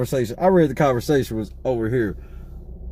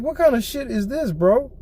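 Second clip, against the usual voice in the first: incredulous; 6 semitones higher than usual. Retold or imitated. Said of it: "I was like".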